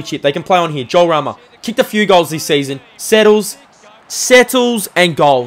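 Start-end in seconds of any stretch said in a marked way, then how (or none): 3.0-5.5 higher and louder, stretched slowly as the goal goes in; excited goal call. Real, not acted. Commentator calling the Thunder goal, excited and drawn out. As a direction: let it rise as the kick goes through.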